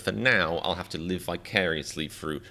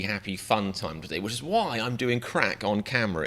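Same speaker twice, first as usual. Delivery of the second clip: ordinary delivery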